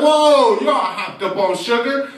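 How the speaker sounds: big surprised dad voice, rising question; jovial father. Acted. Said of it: "His father's like".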